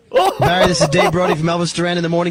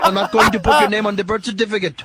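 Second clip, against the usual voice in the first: a light, hammed-up Indian accent; put-on Indian accent. Prank caller quizzing the Irishman, in a light mock Indian accent. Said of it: "stupid of an accent".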